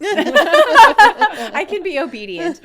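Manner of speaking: high, very loud, slow through laughter; laughing. Real, not acted. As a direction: burst out laughing while saying it. Joking about herself, laughing.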